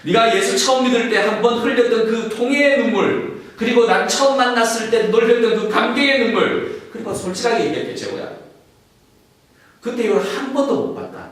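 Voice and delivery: fervent sermon climax; impassioned preaching. Acted. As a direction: cry it out over the crowd. Preacher voicing Jesus, rising passion.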